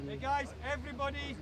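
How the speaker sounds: loud call to gather the team. Real, not acted. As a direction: shout across the field to the players. Coach calls players to huddle.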